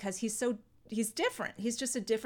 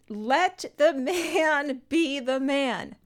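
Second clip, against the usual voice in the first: loud, drawn-out mock-wise advice; slow pronouncement; 4 semitones higher, 5 dB louder, much slower than usual. Acupuncturist advising his patient on her marriage, slow and emphatic. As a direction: imitate a sage, stretching each word slowly.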